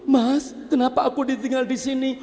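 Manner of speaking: high, fast plea; quick, high questioning plea; pleading wife. Acted. Wife asks husband why she's left, high and fast.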